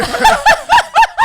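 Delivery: very high, loud shriek of laughter. Real, not acted. Laughing at the joke.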